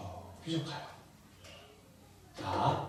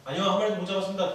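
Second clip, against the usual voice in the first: disciples shouting back; 6 semitones higher, 9 dB louder, faster than usual. Acted. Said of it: "이렇게 대답을 했어요".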